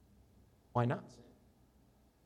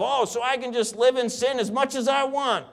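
mock careless idea; 8 semitones higher, 3 dB louder than usual. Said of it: "that idea of".

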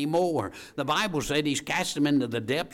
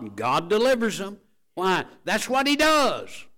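building preaching shout; 8 semitones higher, 7 dB louder than usual. Acted. Preacher rising to a shout before the congregation. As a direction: build from strong to a ringing shout on does.

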